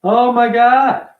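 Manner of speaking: slow, fed-up exclamation; weary exasperation, stretched out slowly; exasperated groan. Real, not acted.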